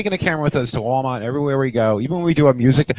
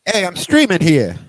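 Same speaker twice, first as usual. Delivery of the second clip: put-on New York accent; 4 semitones higher than usual. Co-host showing off a hometown saying, mock New York accent. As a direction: call it out like a brash New Yorker. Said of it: "We have a saying here".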